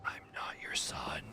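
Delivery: flat whispered denial, breath only; deadpan whisper. Pretend. Player whispering back to the grieving mother, deadpan. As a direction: hush it like a cold reply.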